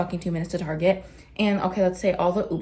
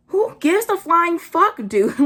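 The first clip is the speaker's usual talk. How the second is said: loud, high, slowed-down disbelief; exasperated outburst; 10 semitones higher, 7 dB louder, slower than usual. Real, not acted. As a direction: explode at how petty this is. Exasperated outburst at trivial detail.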